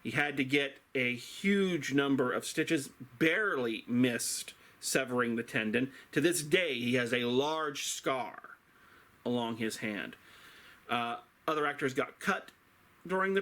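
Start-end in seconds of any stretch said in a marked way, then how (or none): none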